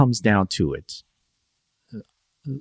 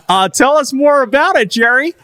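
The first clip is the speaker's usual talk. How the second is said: very high, loud co-host voice; Good Morning America; 6 dB louder than usual. Acted. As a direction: play an eager morning-show co-host through laughter. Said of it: "That's a good impression".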